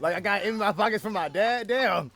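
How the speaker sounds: high, loud joking shout; yelling across the room. Real, not acted. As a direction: shout it over to dad, teasing. Joking about dad's wallet.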